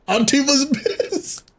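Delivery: high, slow and laughing; giggling remark. Real, not acted. Laughing about the other team.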